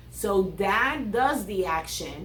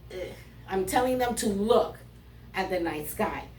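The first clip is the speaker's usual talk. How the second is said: ordinary talk, nothing marked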